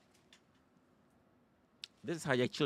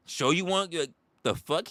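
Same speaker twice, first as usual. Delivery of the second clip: usual speaking voice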